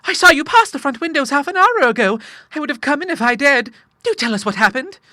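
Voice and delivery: very high, loud woman's voice; eager Clara. A narrator's reading. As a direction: press him for news in falsetto. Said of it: "she cried".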